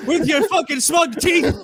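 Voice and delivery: high, hammed-up shout through laughter; mock angry rant. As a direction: yell the insult in mock fury, cracking up. Hammed-up replay of guest's angry insult.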